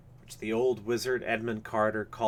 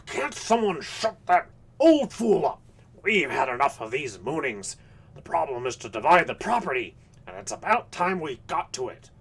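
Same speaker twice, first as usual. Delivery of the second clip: irate, higher-pitched sputtering outburst; apoplectic sputtering; 6 semitones higher, 6 dB louder than usual. A narrator's reading. Aspinwall cuts off the old dreamer, sputtering angrily. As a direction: sputter it out in indignant fury. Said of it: "grew doubly apoplectic looking as he sputtered".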